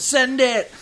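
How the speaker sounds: high, loud catchphrase holler; mock fan shout. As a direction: call it out like a touchline fan.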